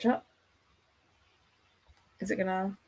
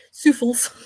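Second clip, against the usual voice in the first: Geordie accent; 5 semitones higher, 4 dB louder than usual. Shown as a demonstration. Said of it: "trust myself to say it".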